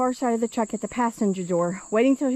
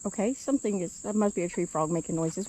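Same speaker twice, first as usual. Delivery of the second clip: usual speaking voice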